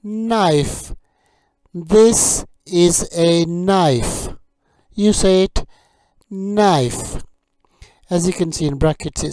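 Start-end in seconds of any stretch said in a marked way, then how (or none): 0.0-7.2 slow, loud, carefully clear teacher drill; vocabulary drill. Acted. Teacher drilling kitchen words for learners, slow and clear. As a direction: model each word for learners to repeat.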